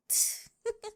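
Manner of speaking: high, bright burst of giggling; giggle, flustered and delighted; giddy giggle. Acted. Giggle after first kiss.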